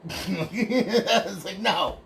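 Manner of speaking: amused refusal broken by laughter; laughing. Real, not acted. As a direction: crack up while retelling your refusal. Laughs retelling his refusal.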